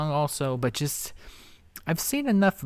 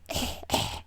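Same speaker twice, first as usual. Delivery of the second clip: fake cough; 5 dB quieter than usual. Mocks the host's cough.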